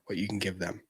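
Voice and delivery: hushed whisper of the key point. Real, not acted. Telling viewers what matters, whispered. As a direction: whisper it like a secret.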